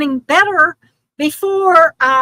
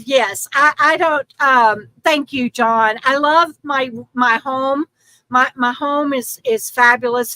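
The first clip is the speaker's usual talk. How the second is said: usual speaking voice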